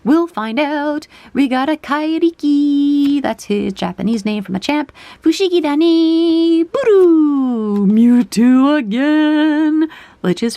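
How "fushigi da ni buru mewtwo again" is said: loud happy exclamation; excited cheer. Real, not acted. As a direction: cheer at the pull. Excited at pulling Mewtwo.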